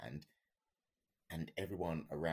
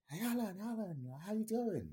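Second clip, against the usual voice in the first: mock instructor shout. Instructor shouting mid-air.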